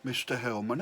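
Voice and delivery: ordinary delivery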